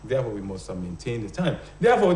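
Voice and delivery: ordinary talk, nothing marked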